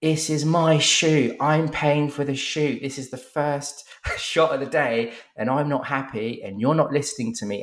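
high, slowed, furious mock shout; retold shouting. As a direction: yell it like an entitled client losing it. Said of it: "he starts shouting at Tom going".

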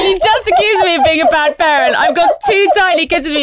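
high, loud indignant rant; angry outburst. Retold or imitated. Mother rants at the man who judged her, high and loud. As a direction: tell him off, outraged. Retold furious outburst at runner.

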